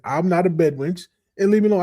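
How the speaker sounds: a hint of an offended sister shooing him off; mock indignant woman. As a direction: play her as fed up and brushing him off. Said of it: "She says".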